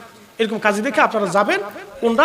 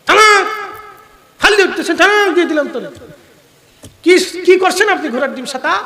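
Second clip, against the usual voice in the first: mock scolding; 8 semitones higher, 5 dB louder than usual. Mock scolding an angry husband.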